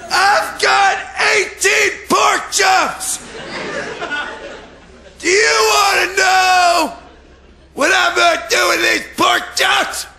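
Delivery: high shouting market trader; hammed-up market seller yell; meat van hawker.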